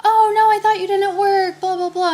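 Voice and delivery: imitating them, high-pitched dismay; exaggerated high-voiced pleading to stay; mock protest. Neighbors objecting as she tries to leave, mock protest.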